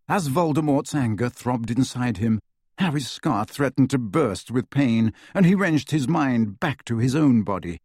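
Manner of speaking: plain reading voice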